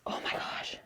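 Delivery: whispered in disbelief; exasperated whisper. Real, not acted. Reviewer worn down by her own rant, whispering. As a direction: drop to a voiceless, worn-out whisper. Fed up mid-rant about book.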